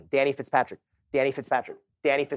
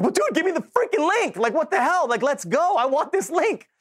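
much higher, mock-whiny demand for the link; imitating his impatient younger self, pitched way up; frustrated demand; 14 semitones higher, 3 dB louder than usual. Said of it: "And I was like".

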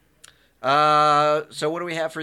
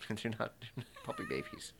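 laughing through the words in disbelief; giggling protest, voice breaking into laughter; 17 dB quieter than usual. Real, not acted. Cohost laughing while correcting puppy babies.

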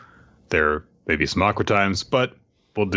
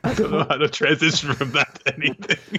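helpless high-pitched laughing talk; 9 semitones higher than usual. Real, not acted. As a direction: laugh through the whole admission. Laughing at impossible transition.

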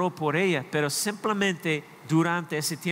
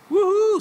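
loud, high whoop of a sports fan; fan cheer; 9 dB louder than usual. Pretend. (grito de aficionado en el estadio, agudo y fuerte)

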